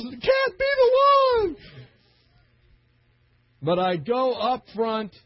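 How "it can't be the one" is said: high, strained, disbelieving cry; mock disbelief. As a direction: squeak out your shock. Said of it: "My brother goes".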